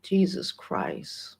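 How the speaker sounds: breathy whispered Jesus Christ, slow; barely voiced, choked up; slow shaken whisper, holding back tears; near tears. Real, not acted. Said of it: "I get emotional".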